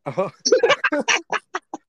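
high, loud laugh; burst of laughter. Real, not acted. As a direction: laugh out, caught off guard. Caught forgetting the bit.

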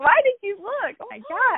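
high, squeaky imitation of a rattled husband; wife imitating her husband's high freaked-out voice; mock freaked-out husband. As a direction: play him up as shaken and squealing.

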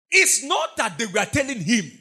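usual speaking voice

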